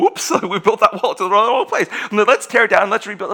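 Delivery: hamming up clueless builders; mock builders. Preacher mocking builders who tore down a wall, laughing and loud. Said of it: "They realize, like".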